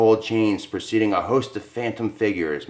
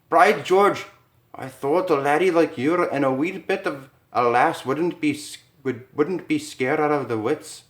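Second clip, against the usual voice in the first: higher, hearty Scottish brogue; Scottish accent; 7 semitones higher than usual. Acted. Scottish bettor greeting the couple, hearty Scottish accent. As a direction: roll it like an old Scotsman.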